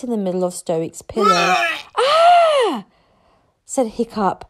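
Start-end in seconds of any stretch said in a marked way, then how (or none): 2.0-2.8 high, loud "ah" scream; horrified cry. Acted. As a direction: cry out in horror. Boy sees dragon make mess.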